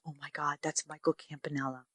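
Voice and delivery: whisper-ish gossip to a friend; hushed aside. Retold or imitated. Girlfriend spotting Michael at the bar, hushed. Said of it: "one of my girlfriends goes".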